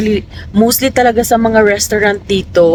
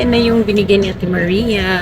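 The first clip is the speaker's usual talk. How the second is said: ordinary talk, nothing marked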